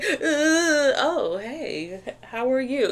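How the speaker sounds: giggly, teary hey; laugh-cracked casual hello; laughing mock greeting. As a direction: fake casual through a laugh. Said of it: "It's like".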